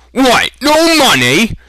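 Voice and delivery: high, loud, indignant cry; sharp outraged shout. A narrator's reading. Butcher shouting at broke customer, high and loud. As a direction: cut him off with a furious yelp. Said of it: "The butcher cut him off sharply".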